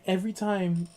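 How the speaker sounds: normal talking voice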